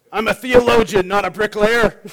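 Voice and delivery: hint of a grumpy doctor's catchphrase; mock Bones. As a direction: snap it like Bones. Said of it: "He's Bones".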